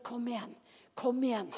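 soft, understated encouragement; a still inner voice, coaxing softly; gentle quiet prompting. Acted. The Holy Spirit prompting from within, gently and softly. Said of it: "stille stemmen i vårt indre".

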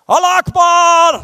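loud, high, slow religious cry; shouting Allah Akbar. Retold or imitated. Retelling the seatmate's cry on the plane, loud shout. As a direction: belt it out like a call to prayer. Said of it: "så roper de".

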